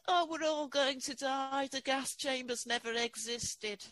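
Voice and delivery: put-on high-pitched French Canadian accent. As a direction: quote the character in a big French Canadian accent. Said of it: "in a big French Canadian accent".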